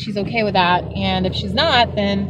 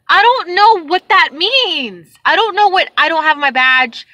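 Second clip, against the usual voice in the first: louder, higher, slow and fed up; retold exasperation with the lost driver; exasperated; 5 semitones higher, 5 dB louder than usual. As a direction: stretch out the disbelief. Mimics frustrated self on phone.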